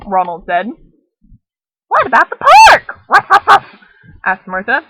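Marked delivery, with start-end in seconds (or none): 1.9-3.7 high, loud yappy puppy barking words; talking dog voice. Acted. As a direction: bark like an eager little dog. Dog Martha voiced with barks.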